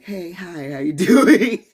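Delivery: high, bright customer-service hello breaking into a laugh; fake cheerful greeting. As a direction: put on the work voice. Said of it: "you're like".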